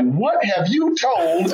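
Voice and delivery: slow, high-pitched mock disbelief; indignant look. Wife gives husband a look about the dress, mock indignant. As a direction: draw it out high, as a mock accusation. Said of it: "cuts me this look like".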